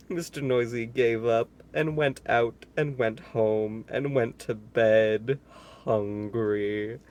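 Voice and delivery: usual speaking voice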